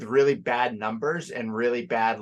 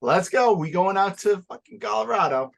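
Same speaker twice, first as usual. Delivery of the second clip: hyped; 9 semitones higher, 4 dB louder than usual. Real, not acted. Hyped about the trip.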